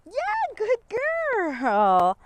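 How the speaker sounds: high, drawn-out cheer for a dog; gushing dog praise. Real, not acted. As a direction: praise the dog in a high, stretched squeal of delight. Praising the dog while training.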